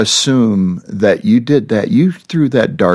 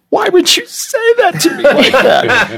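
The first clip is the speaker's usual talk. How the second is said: much higher, squeaky hurt voice; mock offended wife. Husband imitating his wife's offended reply, high and squeaky. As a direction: mimic an offended spouse, laughing.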